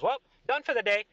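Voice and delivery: high, offhand sign-off; the king can't be bothered; dismissive king. Acted. King leaves bored.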